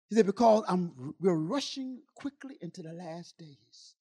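very soft, measured retold instruction; hushed voice of God. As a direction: speak God's words in a hush. Voices God's quiet instruction.

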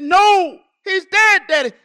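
loud, high, insistent retold cry; imitated anguished daughter. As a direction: break terrible news at full voice. Retells daughter insisting on death.

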